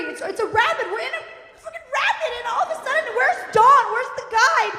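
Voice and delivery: high, rising comic panic; worked-up, high-pitched alarm; retold panic. Reliving panic in the rapid.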